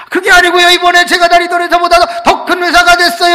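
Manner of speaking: loud, high burst of good news; can barely contain the joy; excited news. Retold or imitated. (크게 높여 신나게)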